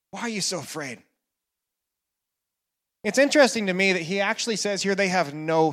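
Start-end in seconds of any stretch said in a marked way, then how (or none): 0.1-1.0 much softer, lower, gentle echo of the question; soft repeat. Acted. Jesus repeating his question to disciples, soft and low. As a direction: ask it again quietly and tenderly.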